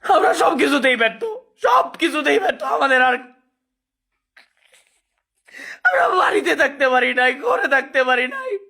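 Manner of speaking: wailing through tears; desperate sobbing lament; snotty crying. Real, not acted. Crying while complaining.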